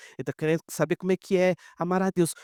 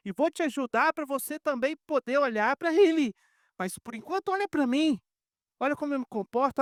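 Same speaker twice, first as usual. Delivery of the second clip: raised, earnest plea to imitate him; preacher voicing Paul urging the church, higher and earnest; Paul's appeal; 9 semitones higher than usual. Lightly voiced apostle pleading. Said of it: "Paulo tá falando".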